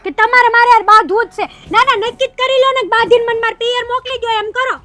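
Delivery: fast, loud, furious rant at her husband; angry outburst. Acted. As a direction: spit it out in a rush, fighting back. Wife flares up in quarrel. (પતિ પર ગુસ્સે થઈ ઝડપથી જોરથી બોલતી પત્ની)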